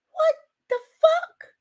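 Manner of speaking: high, stretched cry of disbelief; shocked disbelief. Real, not acted. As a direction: react as if you can't believe it. Shocked by hot tub offer.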